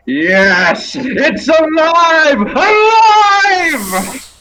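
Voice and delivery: very high, loud drawn-out mad cry; triumphant shout. Acted. Someone shouting from a few rooms ahead, triumphant shout. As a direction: stretch it out in a soaring full shout. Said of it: "just shout".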